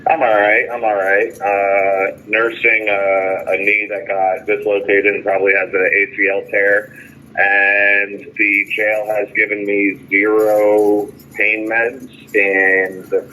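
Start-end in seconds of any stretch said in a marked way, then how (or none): none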